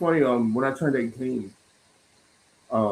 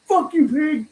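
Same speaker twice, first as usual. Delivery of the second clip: a higher, louder yelled insult, hammed up; mock yell; 14 semitones higher, 7 dB louder, slower than usual. Retelling being booked by his brother, mock yelling. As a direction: shout it like a cuffed teen cursing the cop. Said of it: "He's like".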